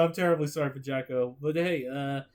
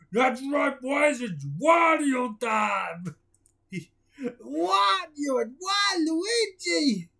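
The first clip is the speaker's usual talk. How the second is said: Wario voice; 5 semitones higher, much slower than usual. Acted. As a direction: burst in as Wario. Wario character boasts.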